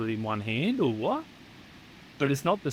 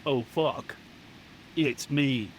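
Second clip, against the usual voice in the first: American accent; slower than usual. Shown as a demonstration. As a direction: carry the American twang into "Oh, fuck. It's me.". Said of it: "Do it the American way".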